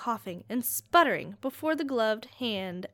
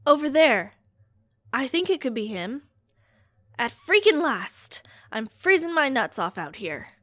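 loud, rough voice of a cold, fed-up searcher; rough searcher calling out; 7 dB louder than usual. Acted. Searcher spots the body in the snow, loud and rough. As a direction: bark it out across the snow, freezing and impatient.

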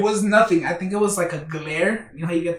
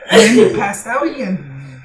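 ordinary talk, nothing marked

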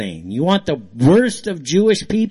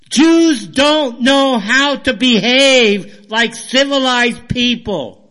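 heated, raised-pitch angry rant; fired up; 7 semitones higher, 3 dB louder than usual. Real, not acted. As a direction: rant in exasperation, hammering the insults in a high, loud voice. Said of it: "just kind of fired up".

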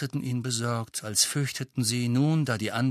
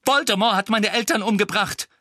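high, louder, words tumbling out; upset boy blurting, higher and louder; stammered outburst; 10 semitones higher, 7 dB louder than usual. Acted. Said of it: "stammelte Harry".

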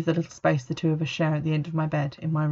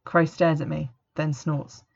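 ordinary delivery

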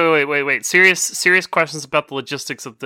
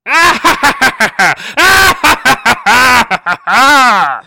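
mad scientist laughing over his victim; evil laugh; 9 semitones higher, 9 dB louder than usual. Pretend. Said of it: "let's hear your evil laugh".

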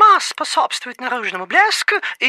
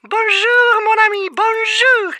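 higher, cheery French greeting; French accent; 7 semitones higher, 4 dB louder than usual. Acted. French coffee pot greets.

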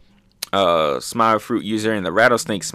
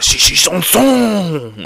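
high, slow, drawn-out battle cry; mock attack call; 7 semitones higher, 5 dB louder, much slower than usual. Reviewer acting out Zoro's lion song move, mock attack call. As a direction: imitate an anime attack call, swelling on the last word.

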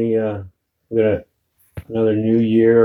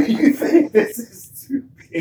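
high squeaky mock voice with a laugh; mocking echo; 13 semitones higher than usual. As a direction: echo it much higher, laughing. Co-host mockingly echoing storyteller's line.

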